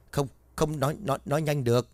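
Mạnh stammering to the guard in fright; terrified stammer. Acted. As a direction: trip over every word in fear.

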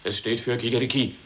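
usual speaking voice

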